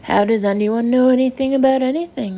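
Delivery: a hint of a bustling old sea captain; blustery captain, brisk and jolly; lightly voiced sea captain. A narrator's reading. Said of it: "the captain replied".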